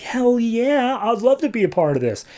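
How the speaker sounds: high-pitched imitation of a woman; eager woman. Woman agrees to drive a hero, high mock-eager voice. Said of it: "And the woman's like".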